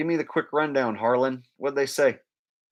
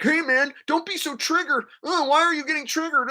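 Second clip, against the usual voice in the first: very high whiny mimicry; mocking flat-smacker voice. Activists answering triggered people in confrontation, high mocking voice. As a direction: mimic them in a silly high voice. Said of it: "they're always like".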